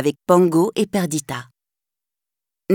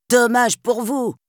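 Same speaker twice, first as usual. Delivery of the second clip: slow, higher mocking sneer; sneering thief; 4 semitones higher than usual. The tall thief shoving Nanny aside, sneering. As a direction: drag it out with a snicker. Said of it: "ricana le plus grand".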